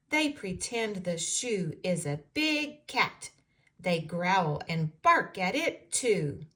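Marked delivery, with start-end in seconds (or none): none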